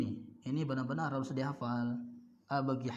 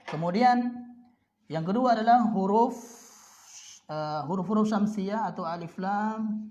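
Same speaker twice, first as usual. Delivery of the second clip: announcing; 6 semitones higher, 7 dB louder than usual. Real, not acted. Teacher announcing next topic.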